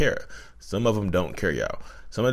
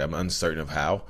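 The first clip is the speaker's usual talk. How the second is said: ordinary talk, nothing marked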